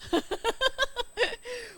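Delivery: high, giggly laugh; real high-pitched laughing fit; amused laughter. Real, not acted. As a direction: burst out laughing. Laughing at children's answers.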